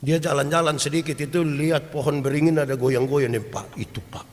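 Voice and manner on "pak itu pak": whispered, pointing out a spooky tree; frightened whisper. Retold or imitated. A scared villager whispering to a man about a swaying banyan tree, hushed and fearful. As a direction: hiss it like you are afraid to be heard.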